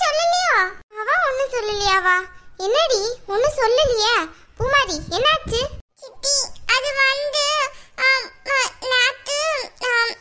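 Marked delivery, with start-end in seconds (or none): 6.0-10.1 slow, stalling drawn-out words; hesitant dragging. Acted. Girl stalling before telling her aunt the bad news, slow and hesitant. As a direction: stall each word, afraid to tell. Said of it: "நேத்து இன்னைக்கு நல்லா இழுக்கிறியே".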